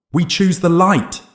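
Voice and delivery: high, loud, drawn-out shout; defiant shout. A narrator's reading. Jake answering the dark figure, high slow shout. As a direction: shout it slowly at full force. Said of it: "Jake shouted".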